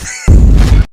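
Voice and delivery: high, loud breathy laugh; high wheeze laugh. Real, not acted. Laughing hard.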